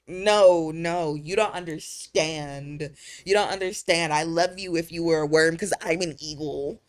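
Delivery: hammed-up pleading insistence; mock insistence. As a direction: lecture the troll loudly, half joking. Mock lecture to the troll.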